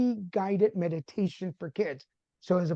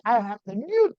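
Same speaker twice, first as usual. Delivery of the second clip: retold excited boast, higher and a bit louder; all excited; 4 semitones higher, 3 dB louder than usual. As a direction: announce it proudly, imitating your own excitement. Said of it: "all excited".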